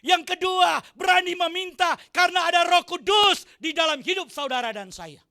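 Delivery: loud, high sermon call; full-force preaching. Acted. Pastor exhorting the congregation with full force. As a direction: preach it out to the church.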